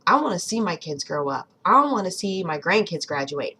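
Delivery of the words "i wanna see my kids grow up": ordinary talk, nothing marked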